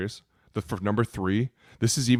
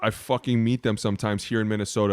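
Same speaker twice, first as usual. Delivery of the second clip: usual speaking voice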